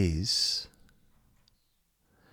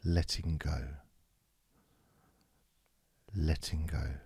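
soft spoken; 6 dB quieter than usual. Shown as a demonstration. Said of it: "we're just gonna say".

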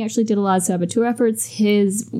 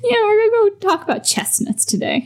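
hammed-up cheerful squeak; mock chirpy; 12 semitones higher than usual. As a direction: chirp it like a cheesy announcer. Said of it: "I just like".

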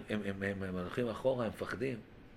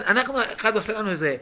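normal talking voice

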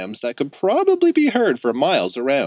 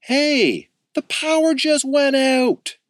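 calling out; slower than usual. A narrator's reading. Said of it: "someone called".

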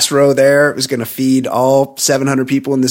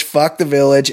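ordinary delivery

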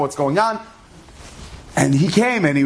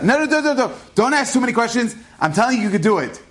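higher, pressing no no no; insistent reassurance; 6 semitones higher than usual. Retold or imitated. Reb Zelig urging Reb Shalom not to ask questions, insistent and higher. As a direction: wave off his doubts eagerly.

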